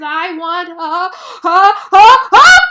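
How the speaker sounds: gasping toward a sneeze; sneeze build-up. A narrator's reading. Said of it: "so he answers".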